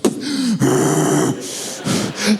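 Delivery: imitated furious child's heavy breaths; mock seething breaths; angry breathing. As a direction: huff and puff like a kid too mad to cry. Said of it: "they're doing that angry breathing".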